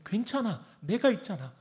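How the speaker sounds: rising from gentle comfort to a loud, high call; God the Father reassuring. Acted. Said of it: "라고 주님은 계속해서 외치고".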